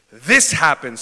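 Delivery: big shouted this happened. Shown as a demonstration. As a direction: call it out loud to the room. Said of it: "Someone shout".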